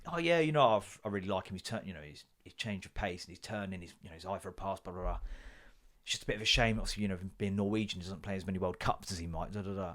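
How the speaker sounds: mumbled pundit. Retold or imitated. Impersonating himself talking football.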